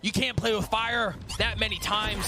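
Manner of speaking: ordinary delivery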